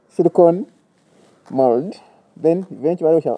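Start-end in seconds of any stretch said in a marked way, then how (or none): none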